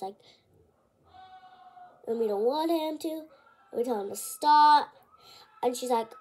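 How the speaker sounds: normal talking voice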